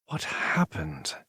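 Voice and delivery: soft, slow worried question; gentle concern. A narrator's reading. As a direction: ask gently. Concerned friend.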